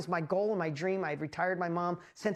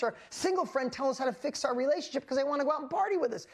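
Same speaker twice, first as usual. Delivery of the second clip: excited inner voice; 6 semitones higher than usual. Retold or imitated. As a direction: replay the thrill of finally getting it. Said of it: "I'd be like".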